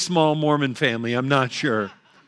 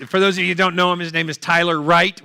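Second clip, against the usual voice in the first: normal talking voice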